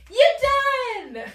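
very high, loud happy shout; excited cheer. Real, not acted. End of shakeout, cheering kids.

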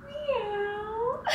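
high, soft, giggly meow; a playful high kitty meow for the baby; cat meow. Shown as a demonstration. Mom meowing for her baby, high and giggly. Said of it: "The cat goes meow".

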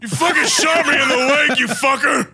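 angry yell at the man who shot him; furious yell. Acted. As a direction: scream the insult at him. Shot, yelling at shooter.

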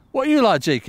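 loud, high-pitched call to a mate; calling across. Real, not acted. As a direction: throw the question out loudly. Calls across to Jake with question.

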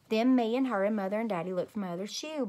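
ordinary delivery

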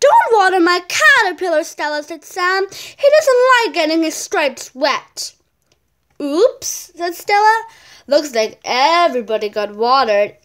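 loud, lively read-aloud voice for a picture book; bright storybook reading; reading a story aloud. Acted. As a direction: read it out to the class with energy. Child reads story aloud.

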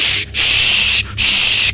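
whispered shushing; hushing. Acted. A prisoner silencing the crying inmate, whispered. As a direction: shush him hard.

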